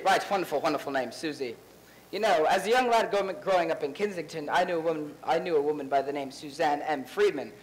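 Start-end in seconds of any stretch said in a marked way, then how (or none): 2.1-7.5 put-on English accent, lightly done; fake English accent. Actor from New York fakes an English accent to the cast. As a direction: play a New Yorker faking an English accent. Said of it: "Your accent".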